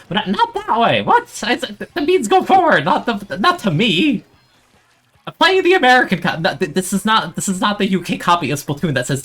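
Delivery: high, loud, outraged yelling; flustered gamer shouting way up high; frantic protest. Real, not acted. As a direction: yelp in panicked disbelief and stumble over the words. Outraged as teammates go wrong way.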